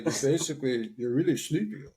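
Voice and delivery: lazy mouth, words half-melted; Stallone impression. Retelling buddy's impression, sleepy slurred Stallone voice. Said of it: "he's like (Stallone impression)".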